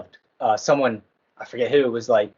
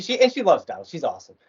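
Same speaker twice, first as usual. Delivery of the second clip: ordinary talk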